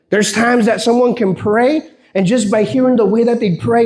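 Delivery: usual speaking voice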